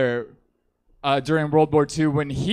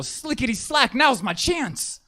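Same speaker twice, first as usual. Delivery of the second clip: put-on higher, slow hokey old-timer voice; goofy old-timey grandpa; 10 semitones higher than usual. Comic voicing his grandfather eyeing the guards, higher and hammed up. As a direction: ham up grandpa with a corny catchphrase. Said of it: "that's how he talked".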